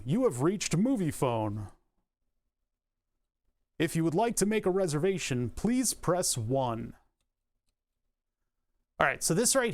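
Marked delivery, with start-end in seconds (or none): 0.1-6.9 stiff automated operator voice; robotic phone voice. Shown as a demonstration. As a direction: answer like a robot menu. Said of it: "answer it as robotically as I can".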